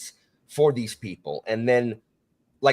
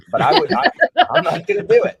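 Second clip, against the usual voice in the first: higher, louder, breaking into laughter; laughing refusal; 8 dB louder than usual. Real, not acted. Podcast guest refusing to do an accent on air, laughing. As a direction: laugh helplessly through the protest.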